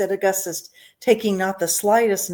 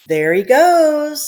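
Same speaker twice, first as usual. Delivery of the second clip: high, loud pointing shout; crowd shout; 9 semitones higher, 9 dB louder, much slower than usual. A narrator's reading. Onlooker shouting as the boy shoots up the pipe. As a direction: call it out to the crowd. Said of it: "somebody shouted".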